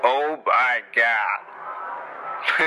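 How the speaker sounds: slow, drawn-out stunned doctor; a lightly voiced doctor, dragging each word; doctor in shock. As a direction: let it stretch out, stunned and slow. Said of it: "the doctor gets out and says".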